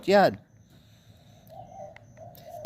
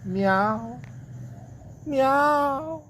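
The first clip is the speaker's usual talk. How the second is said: high, long-drawn imitated meows; cat meow; 12 semitones higher, 3 dB quieter than usual. Cat lying on the floor, high imitated meowing. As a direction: meow twice as a cat, the second even higher. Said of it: "The cat with the big ass come here and say".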